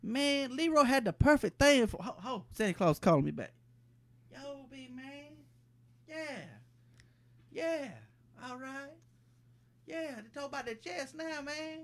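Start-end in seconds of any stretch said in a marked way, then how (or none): 4.3-11.8 much softer, murmured into a pretend phone; mumbled phone call. Leroy murmuring to Santa on his pretend phone, much softer. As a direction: mutter into the hand like it's a phone call.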